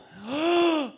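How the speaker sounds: sharp high gasp of shock; startled gasp. Retold or imitated. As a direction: suck in air suddenly. Shows the shock of the woman.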